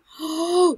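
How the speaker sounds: high, loud gasp of delight; big thrilled intake of breath; excited gasp. Real, not acted. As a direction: gasp sharply at a lucky find. Spots wildflowers in the game.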